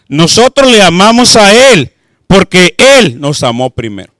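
loud, declaiming Bible verse; fervent scripture reading. Acted. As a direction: proclaim the verse to the church. Reads scripture aloud loudly.

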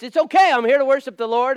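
high, loud insistence; loud self-reassurance. Retold or imitated.

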